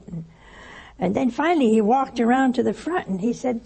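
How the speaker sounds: normal talking voice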